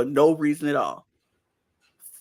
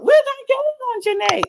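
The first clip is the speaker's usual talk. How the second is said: much higher mock voice; imitating Kayla; 4 dB louder than usual. Podcaster imitating Kayla snapping at Serena, high mock voice. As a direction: squeal it as a mocking impression.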